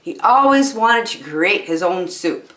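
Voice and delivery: ordinary delivery, nothing marked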